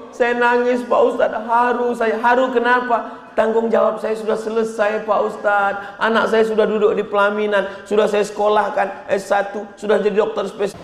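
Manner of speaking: high, touched mother's voice; tearful mother. Acted. Mother tells the ustadz why she cries, high and touched. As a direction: speak as a moved mother at the wedding. Mother crying at wedding, talking.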